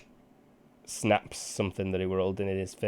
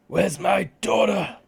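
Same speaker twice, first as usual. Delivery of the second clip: high, slow anguished scream; 12 semitones higher, 10 dB louder, slower than usual. Acted. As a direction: scream it out at full force. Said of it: "he screams".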